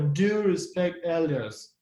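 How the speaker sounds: slow, weighty DO; stress on DO. Shown as a demonstration. Teacher repeating an important order, stress on DO. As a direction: stretch and lean on Do. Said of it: "show the emphasis on one specific word".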